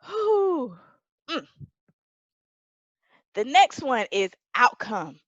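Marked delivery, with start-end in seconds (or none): 0.1-0.8 high, breath-catching exhale; long whew. Real, not acted. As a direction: blow out a relieved whew after exertion. Catching breath after fervent preaching.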